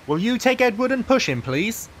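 a touch high and quick, asking. A narrator's reading. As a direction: ask politely in a lighter voice. Porter asks driver.

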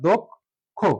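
দোখ stopped short, pause, then খো; "dok... kho" with a gap between syllables; split into syllables. Shown as a demonstration. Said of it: "এই জন্য এটা হচ্ছে বদ্ধাক্ষর". (থেমে থেমে "দোক্... খো")

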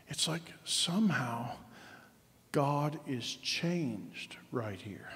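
slow, breathy whisper of awe; hushed whisper. Real, not acted. Preacher whispering to the congregation in awe, slow whisper. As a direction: lean in and whisper it slowly, as if it's a secret.

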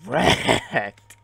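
loud, stretched-out gloat; triumphant taunt. Real, not acted. Gamer gloating over a kill, loud taunt. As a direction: shout it long over the kill.

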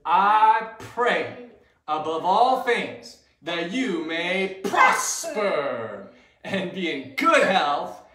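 slow, measured group recitation; verse with actions. Shown as a demonstration. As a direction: lead it phrase by phrase, leaving room for gestures. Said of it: "let's do it with some actions".

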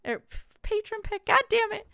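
high, loud self-annoyed cry; frustrated outburst. Real, not acted. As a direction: snap at your own stumble. Frustrated at own misread.

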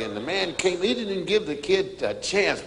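normal talking voice

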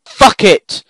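loud, high-pitched outburst; furious shout. Real, not acted. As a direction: explode in real anger. Outburst at the game.

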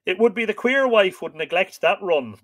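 loud, high scoffing retort; growled retort. A narrator's reading. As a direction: throw it out as a gruff jibe. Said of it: "Conan growled".

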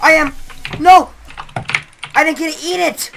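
loud frustrated yelling. Real, not acted. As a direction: shout it in frustration. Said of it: "Why are you yelling".

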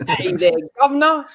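high-pitched mock Cockney British greeting; mock British accent. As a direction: chirp it as a British caricature. Continuing the mock British bit.

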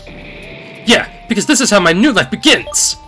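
loud gloating shadow vow; triumphant villain. Acted.

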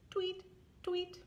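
high, soft little tweets; soft bird tweet. Shown as a demonstration. Librarian shows the toddlers how the bird sign says tweet, high and soft. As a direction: peep like a tiny bird. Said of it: "And it's bird".

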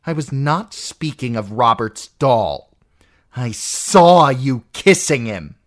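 an aunt accusing her niece; jealous aunt. Acted. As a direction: hurl the accusation. Lysa accusing Sansa.

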